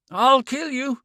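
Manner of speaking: high, loud, broken gasping threat; gasped threat. A narrator's reading. Man threatening the woman in broken gasps. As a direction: spit it out between gasps. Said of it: "he was muttering in short, broken gasps".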